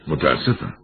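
usual speaking voice

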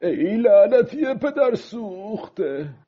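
high, drawn-out furious curse; upset, angry curse. Acted. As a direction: curse the shark angrily, stretching the words. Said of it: "ناراحت و عصبی گفت". (نفرینِ عصبی و کشیده)

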